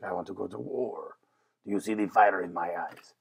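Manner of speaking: a hint of a fiery queen spoiling for war; mock-dramatic warrior queen; fierce empress voice. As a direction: lightly play the queen craving battle. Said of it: "I don't know why I'm talking like that".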